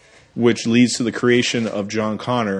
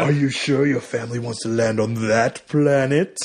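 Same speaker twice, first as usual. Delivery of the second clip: over-the-top Doctor Smith. Retold or imitated. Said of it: "he's very over the top".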